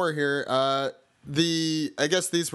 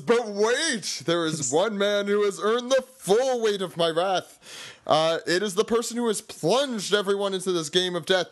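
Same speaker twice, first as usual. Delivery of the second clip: dramatic villain voice; 6 semitones higher than usual. Retold or imitated. Doflamingo's dramatic announcement.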